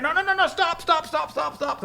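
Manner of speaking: high, rapid-fire mock protest; frantic string of high stops; panicked interruption. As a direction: cut him off in hammed-up alarm. King interrupts the blessing.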